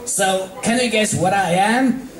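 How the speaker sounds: high playful tomboy voice; Rainbow, playful quiz; Rainbow Dash. Acted. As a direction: tease her with the question. Rainbow quizzes friend.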